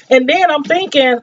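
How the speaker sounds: usual speaking voice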